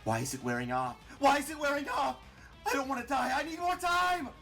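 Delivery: desperate, high, loud outburst; panicked cry. A narrator's reading. Martlet losing her battle with Clover, desperate cry. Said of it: "she cries as the battle rages on".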